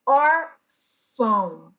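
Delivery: ÓRFÃO drawn out, the ÃO nasalized; syllable-by-syllable demonstration. Shown as a demonstration. Teacher showing the tilde's sound, órfão slowly split with nasal ÃO. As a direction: say órfão slowly, letting ÃO hum through the nose. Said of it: "nasalizar a vogal".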